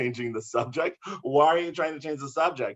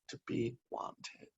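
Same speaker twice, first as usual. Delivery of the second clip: slow whisper, much softer; 3 semitones lower, 14 dB quieter, slower than usual. Acted. Poet confessing loneliness to the audience, slow whisper. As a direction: breathe the words out like a secret.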